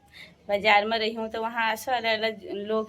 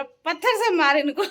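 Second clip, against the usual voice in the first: higher, laughing mock shout; retold shout; 6 semitones higher, 4 dB louder than usual. Man above urging her to throw stones, retold shout. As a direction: imitate a call from above, amused.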